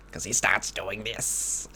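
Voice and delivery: slow, sinister villain imitation; Green Goblin. Fan showing how the actor's voice changes, Goblin impression. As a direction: draw it out like a mock villain. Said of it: "the most obvious thing is the voice".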